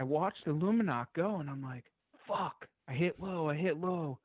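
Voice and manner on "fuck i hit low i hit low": higher, worried rush of a hunter who thinks he missed; panicky, pitched up; anxious self-talk after a bad shot; retold panic. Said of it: "and I freak out".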